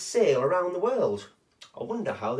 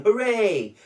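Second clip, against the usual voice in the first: higher, louder hooray; cheering shout; 7 semitones higher, 5 dB louder than usual. Acted. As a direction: cheer for the whale. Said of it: "villagers shouting".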